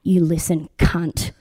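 put-on loud, menacing Manchester accent threat; thick Manchester accent. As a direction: growl it like a hard Manchester mum. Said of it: "in the most thick Manchester accent you could imagine".

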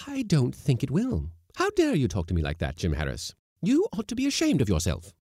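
high, loud telling-off; indignant scolding. A narrator's reading. Cook telling Jim Harris off, loud indignant scolding.

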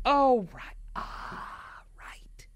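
high then whispered oops; sheepish realization fading to a whisper. Retold or imitated. Mom realizes her mistake.

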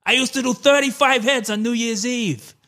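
a rough DeVito impersonation; Danny DeVito impression. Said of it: "not really his voice either".

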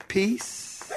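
soft, small, questioning; soft, tentative word with a slight lift; timid take. Shown as a demonstration. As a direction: say it meekly, with no command in it. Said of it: "I don't think he said".

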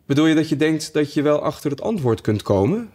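March Hare voiced. A narrator's reading. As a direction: play the hare, a little sly. (hoger, vreemd hazenstemmetje)

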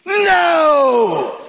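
high, loud anguished scream; child's crying scream of protest. Retold or imitated. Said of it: "And he screamed".

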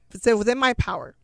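ordinary talk

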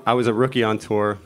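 ordinary talk

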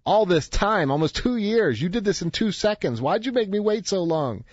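high-pitched, indignant customer's complaint; wealthy man rants at the Zen master, high and indignant; mock anger. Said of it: "his happiness turns to anger".

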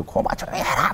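retold Gyeongsang dialect order to pipe down. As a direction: imitate a senior guard in Gyeongsang dialect. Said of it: "경상도 사투리로".

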